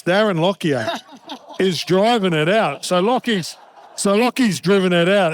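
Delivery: laughing. Real, not acted. Laughing at the bus prank.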